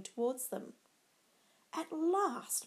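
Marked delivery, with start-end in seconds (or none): 1.7-2.7 sarcastic. A narrator's reading. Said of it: "Mercutio said sarcastically".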